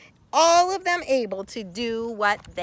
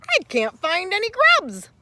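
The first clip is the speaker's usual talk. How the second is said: higher, louder sad beetle voice; crying beetle; 10 semitones higher, 5 dB louder than usual. Acted. Sad ground beetle crying to Carl, high. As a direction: wail it like a tiny sad beetle. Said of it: "a voice cried".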